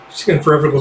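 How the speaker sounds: normal talking voice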